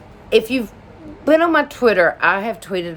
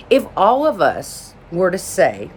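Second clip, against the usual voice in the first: ordinary delivery, nothing marked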